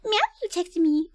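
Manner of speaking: lightly voiced giddy teen; mock excited girl. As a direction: replay thirteen-year-old excitement. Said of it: "I was like".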